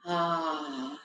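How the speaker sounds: soft, low sighing ah on the out-breath; exhaled ah. Shown as a demonstration. Guide demonstrating the ah sound on exhale, soft sigh. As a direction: let the breath out as a gentle ah. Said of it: "let's breathe with a ah sound".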